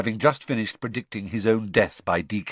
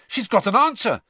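higher, louder, eager; excited; 13 semitones higher, 5 dB louder than usual. Acted. Ron to Harry about the owl's letter, excited. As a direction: point it out eagerly. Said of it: "said Ron excitedly".

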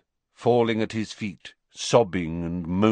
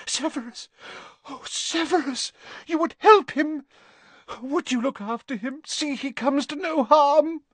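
high, breathless with sudden hope; hopeful Narcissa. A narrator's reading. Narcissa clutching at hope from Snape, high and eager. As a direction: cry out his name.

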